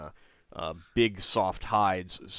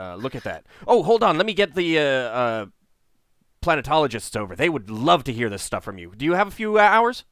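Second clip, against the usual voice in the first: stammering, enthusiastic gnome, pitch bouncing about; excited fast-talking gnome. Acted. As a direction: gush with fascination as a chattery gnome. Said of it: "He's going way too fast".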